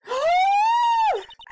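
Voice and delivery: sharp high shocked gasp; a startled gasp at a sudden figure; loud gasp. Acted. Pearl startled by Adrian appearing, loud high gasp.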